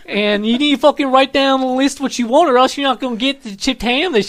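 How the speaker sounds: high, loud yinzer; Pittsburgh accent. Pretend. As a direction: tell Debbie you're off to Giant Eagle, full yinzer. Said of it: "And it's like".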